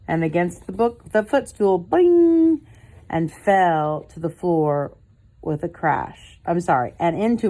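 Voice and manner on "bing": high, loud bing sound; a loud ringing bing. A narrator's reading. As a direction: punch out a bright bing. Sound effects in story.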